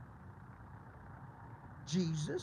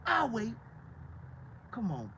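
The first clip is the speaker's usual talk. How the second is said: ordinary delivery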